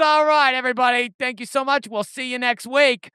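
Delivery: friendly Boston-style goodbye; mild Boston accent closing the show. Pretend. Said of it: "do the outro in a Boston accent".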